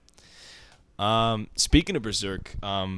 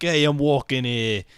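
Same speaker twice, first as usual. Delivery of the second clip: dog as a brash Brooklyn local, raised volume; thick Brooklyn accent; 5 dB louder than usual. Acted. Dog talking to another dog, thick Brooklyn accent. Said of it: "really thick, um, Brooklyn accents".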